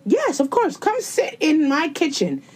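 high-pitched sarcastic welcome; mock over-welcoming mother. Mocking a mom inviting a man into her kitchen, sarcastically welcoming.

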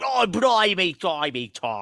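high, loud Cockney "Oh, blimey"; playful Cockney impression of Lila; Cockney accent. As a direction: exclaim it like a London Cockney. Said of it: "she like more Cockney".

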